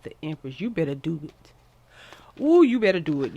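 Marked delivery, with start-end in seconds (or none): 2.4-3.4 loud hype. Real, not acted. Hyping the Empress card.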